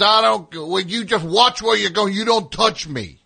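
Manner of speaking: high, loud mocking yell; loud, high-pitched mocking rant; mock angry cop. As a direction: ham up the indignant bully. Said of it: "He goes".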